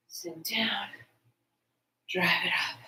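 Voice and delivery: breathy whispered cue, voice dropped low; whispering. Shown as a demonstration. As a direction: whisper the rep cue under your breath. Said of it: "instructor cueing repeated rep".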